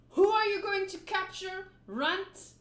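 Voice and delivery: put-on booming threat to a cowering boy; menacing pirate captain. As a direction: loom over the runt and bark it.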